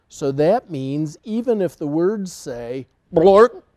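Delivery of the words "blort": shouted nonsense word; loud silly word. Acted. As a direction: blurt it out loud. Book forces silly word.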